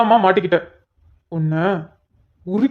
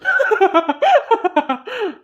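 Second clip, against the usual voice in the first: high rising burst of laughter; officer laughing at the constable's timing; amused laugh; 10 semitones higher than usual. Acted. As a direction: crack up at the joke. Laughing at the witty comeback.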